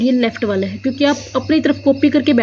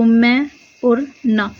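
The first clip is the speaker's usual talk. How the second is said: syllables pulled apart; much slower than usual. Shown as a demonstration. Teacher splits word into syllables.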